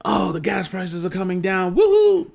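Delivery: high, sarcastic mock celebration ending in a whoop; mocking cheer. Vlogger mocking gas-price optimists, high sarcastic whoop. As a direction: parody a gullible fan cheering falling prices.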